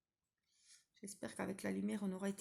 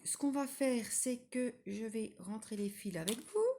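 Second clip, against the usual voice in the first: usual speaking voice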